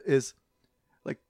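usual speaking voice

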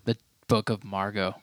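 low, hushed reverent echo; hushed solemn echo. Pretend. Solemn title echoed softer.

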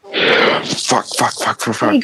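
whispered repeated swearing; panicked whisper. Real, not acted. Player reacting to character collapse.

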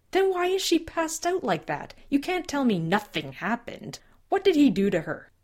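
high, loud angry demand. Acted. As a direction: shout the questions at him. Angry father demands answers.

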